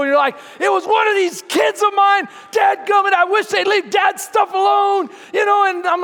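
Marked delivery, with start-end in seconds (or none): 0.6-5.1 high, loud frustrated dad rant; hammed-up angry shouting; yelling at kids. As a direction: holler it like a fed-up dad. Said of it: "like, yelling at the kids".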